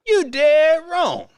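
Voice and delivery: loud, slow you dead wrong; mock scolding. Host scolds the commentator, loud and slow. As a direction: call him out, loud and drawn out.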